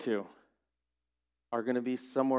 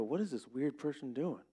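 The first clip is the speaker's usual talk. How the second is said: suspicious muttering; 6 dB quieter than usual. Retold or imitated. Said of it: "walk on the other side of the street and go".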